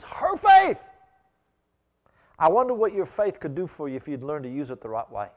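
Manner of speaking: loud, ringing sermon delivery; preaching at full force. Acted. Preacher rousing the congregation about faith, loud and ringing.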